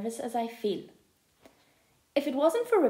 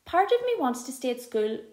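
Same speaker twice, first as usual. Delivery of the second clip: usual speaking voice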